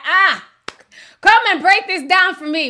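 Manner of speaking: loud, high coaxing call to mom; pleading call. Retold or imitated. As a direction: beg her to come explain. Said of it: "I was like".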